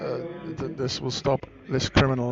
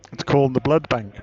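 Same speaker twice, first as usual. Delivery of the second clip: louder, insistent restatement; insistent repeat; 8 dB louder than usual. Acted. Prank caller repeats the answer to a doubting scammer, insistent. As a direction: repeat it forcefully, much louder.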